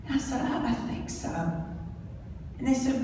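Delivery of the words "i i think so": breathless stunned whisper; dazed whisper. Retold or imitated. Shaken driver answering rescuers from an upturned car, whispering. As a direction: barely get the words out.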